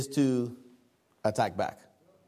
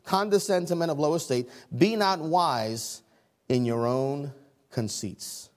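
normal talking voice

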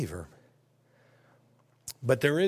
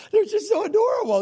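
gushing; 7 dB louder than usual. Real, not acted. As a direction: squeal it out, melting over the babies. Gushing over grandkids.

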